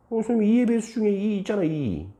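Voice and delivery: very high-pitched pupil voice; high, put-on student voice; student answering. Student answers the teacher's why.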